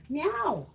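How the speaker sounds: high playful meow at the cat; mock meow. Answering her cat, a high playful meow.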